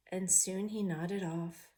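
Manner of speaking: ordinary delivery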